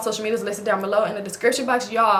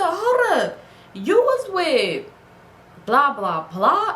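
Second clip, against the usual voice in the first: high, slow, confronting; accusing; 5 semitones higher, 3 dB louder, much slower than usual. Retold or imitated. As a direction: call him out, drawn out. Confronting boyfriend on phone.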